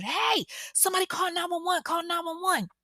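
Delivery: high, urgent call for nine one one; yelling for help. Retold or imitated. The neighbor yelling at the crowd, urgent and high. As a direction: shout to the street for help. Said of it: "the neighbor's yelling".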